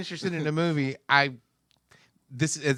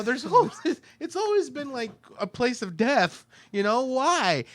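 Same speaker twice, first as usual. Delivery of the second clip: exasperated rant; 10 semitones higher, 3 dB louder than usual. Retold or imitated. Exasperated rant about Australia.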